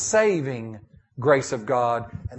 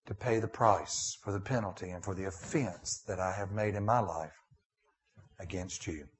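prayer; 5 semitones lower, 6 dB quieter than usual. Acted. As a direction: pray quietly to the Father. Leads closing prayer.

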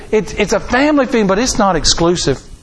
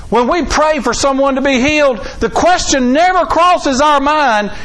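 preaching at full force; 9 semitones higher than usual. Acted. Pastor preaching to his congregation about healing, full force. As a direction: lift it up into full preaching fire.

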